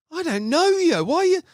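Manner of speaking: high-pitched indignant comeback; indignant retort. Retold or imitated. Retelling his reply to a stranger's nose insult, indignant.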